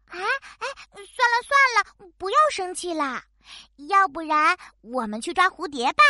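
coaxing. Acted. Cat soothes quarrel.

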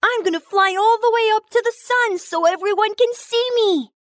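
high, boastful eager pea; excited. Acted. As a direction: show off, bursting with excitement. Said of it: "jumped about in excitement".

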